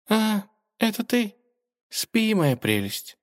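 tender. Acted. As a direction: murmur lovingly, half asleep. Царевна ласкает игрушку. (нежно, сонно)

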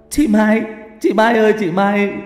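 high, loud desperate call; anguished wailing cry. A narrator's reading. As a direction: scream for your sister in terror. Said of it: "gào khóc thảm thiết gọi chị".

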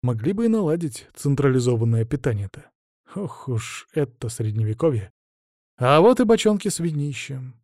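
ordinary delivery

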